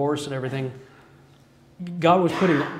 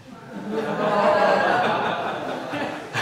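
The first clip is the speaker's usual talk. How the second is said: high amused laugh; 6 semitones higher than usual. Real, not acted. The speaker laughs at being told to read Hosea. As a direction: burst of laughter at the memory.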